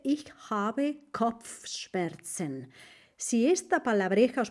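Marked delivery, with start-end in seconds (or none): 0.0-2.7 slow, clear German phrase; teacher modelling a German phrase; slow model phrase. Shown as a demonstration. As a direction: pronounce it slowly for the class. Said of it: "se dice".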